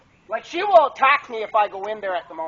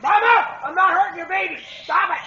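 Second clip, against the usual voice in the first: alarmed pleading; 7 semitones higher than usual. Real, not acted. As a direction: beg her to stop, voice raised. Sow attacking, he pleads.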